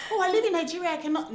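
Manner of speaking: high, loud mock protest; mock complaining viewer. Host mimicking a viewer making excuses, mock high-pitched complaint. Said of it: "So do not be like".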